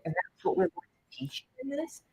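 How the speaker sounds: normal talking voice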